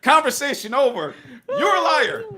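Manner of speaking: high, squealing laughter in the words; mock outrage through helpless laughter; laughing accusation. Real, not acted. Jokingly calling out crypto liars.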